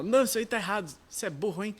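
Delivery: high, loud scornful put-down; mock scolding. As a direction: imitate a friend mocking your mistake. Friend scolding a learner. (repreensão zombeteira, mais aguda e alta)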